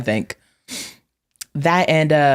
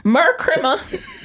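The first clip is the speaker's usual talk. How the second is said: high, slow, laughing Mer Crimma; mock Maryland Christmas; 13 semitones higher, much slower than usual. Repeating family slang, a high laughing Mer Crimma. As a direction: stretch out Mer Crimma like a local.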